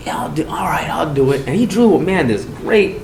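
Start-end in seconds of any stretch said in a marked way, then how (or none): 0.1-1.5 whispered, softer reluctant yes; whispered agreement. Retold or imitated. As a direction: give in under your breath. Inmate agreeing quietly for snacks.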